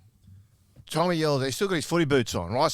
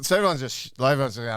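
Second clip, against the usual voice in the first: ordinary delivery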